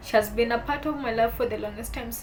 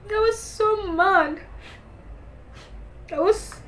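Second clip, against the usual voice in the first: tearful anger; 5 semitones higher, 4 dB louder than usual. Real, not acted. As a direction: voice rising high and loud, near tears, angry at her lost sister. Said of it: "sorry guys".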